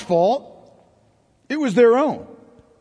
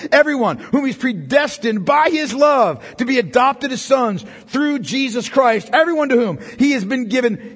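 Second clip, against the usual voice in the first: usual speaking voice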